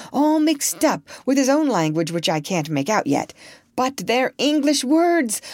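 a lightly voiced excited doctor. A narrator's reading. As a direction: urge him on, barely containing excitement.

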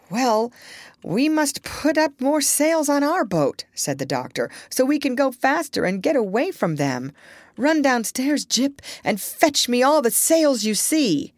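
urgent doctor. A narrator's reading. Doctor gives orders.

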